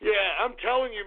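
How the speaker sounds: normal talking voice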